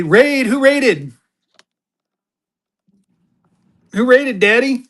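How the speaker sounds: high, loud surprised shout-out; excited call-out. Real, not acted. Calling out an incoming raid.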